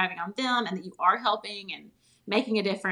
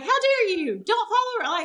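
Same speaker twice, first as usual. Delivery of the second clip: high, loud indignant mockery; mocking her sister's huffy defense against a commenter, high and loud; 13 semitones higher, 6 dB louder than usual. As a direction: ham up the big sister's outrage.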